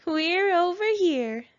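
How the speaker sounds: cheeky call from nearby; crying out. A narrator's reading. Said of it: "they cried".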